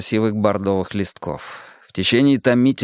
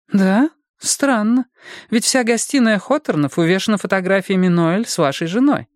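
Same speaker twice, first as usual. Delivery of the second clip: high-pitched, sceptical detective; detective Bonnie, doubting; 8 semitones higher than usual. Acted. Detective challenges husband about the photographs, doubting higher voice. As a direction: question his account in a higher voice. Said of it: "нахмурилась Бонни".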